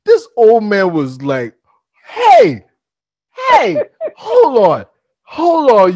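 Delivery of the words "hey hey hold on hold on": high, loud stunned shout; old man calling out. Acted. Old man stopping the boys with the ducks, loud and high. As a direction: call after them, amazed.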